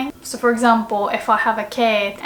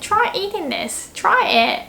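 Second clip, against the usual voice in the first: bright raised pitch, inviting; high friendly offer; 9 semitones higher than usual. Shown as a demonstration. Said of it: "If you wanna say".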